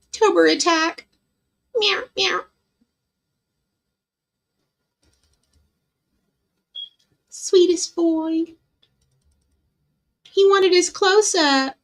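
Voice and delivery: high-pitched cooing at a cat; pet talk. Real, not acted. Owner fussing over a cat wanting his close-up, high pet talk. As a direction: coo over your sweet pet.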